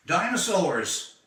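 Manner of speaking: usual speaking voice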